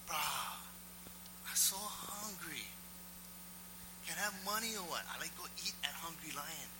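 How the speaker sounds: imitated pleading local beggar; begging stranger. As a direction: wheedle like a hungry guy asking for cash.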